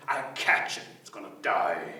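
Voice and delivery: low, rough threat; dark gator growl; menacing alligator. Acted. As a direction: sink deep on the threat.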